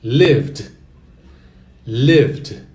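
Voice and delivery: "lived" said loud with a silent D; "lived" loud, stressed at the start; the past participle "lived" as a model; model pronunciation. Shown as a demonstration. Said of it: "mesma coisa".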